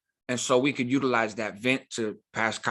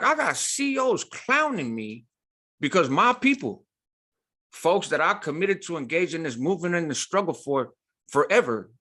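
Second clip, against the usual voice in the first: inner fuming; 6 semitones higher than usual. Retold or imitated. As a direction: let the betrayal boil up inside. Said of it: "I am fuming".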